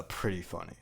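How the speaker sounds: ordinary talk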